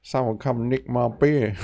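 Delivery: put-on beer lover's slow whine; trial drunk voice. Trying out beer quest character voices.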